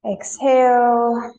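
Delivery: very slow, loud, held exhale cue; sustained yoga teacher's cue; drawn-out breathing cue. Real, not acted. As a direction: stretch it out as long as the breath. Leading the class's breathing.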